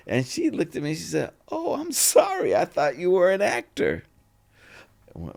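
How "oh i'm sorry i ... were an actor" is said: high-pitched sarcastic sorry; lifted pitch, pointedly over-innocent; mock apology. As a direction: imitate her needling him with a fake apology. Retells actress's cutting mock apology.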